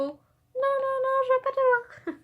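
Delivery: high squeaky refusing toy voice; mock-sulky toy rejecting Albus, high-pitched; sulky figurine. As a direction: whine it like a huffy little toy. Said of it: "je joue avec mes figurines".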